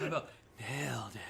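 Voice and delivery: giggly gremlin croak; sneering little imp with a laugh. Pretend. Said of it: "you sounded like a gremlin on that last one".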